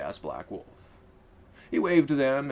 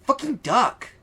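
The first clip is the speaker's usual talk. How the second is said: much higher, louder furious warning shout; angry yell; 5 dB louder, slower than usual. Acted. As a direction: scream it in frustration. Laura repeats urgent warning.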